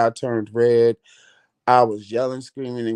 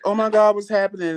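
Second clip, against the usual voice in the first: alarmed; 7 semitones higher, faster than usual. Retold or imitated. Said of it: "every machine went off beeping".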